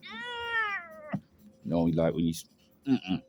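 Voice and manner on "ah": very high, thin pained squeak; pained squeal. Retold or imitated. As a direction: squeak like the pain just hit. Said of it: "I was like".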